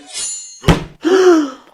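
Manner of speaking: hoquet de stupeur très aigu et fort; halètement choqué. Acted. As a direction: souffle coupé d'horreur. Le chien devenu gâteau. (cri étouffé de choc, très aigu)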